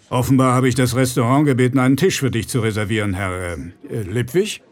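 a powerful man's cold politeness; bear-like rich man. Acted.

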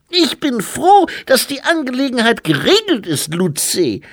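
very high, loud infant voice; squeaky toddler speechifying loudly; baby-like abbot. A narrator's reading. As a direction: play a dignified abbot in a baby's piping voice.

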